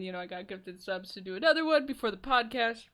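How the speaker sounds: ordinary talk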